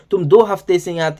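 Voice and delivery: normal talking voice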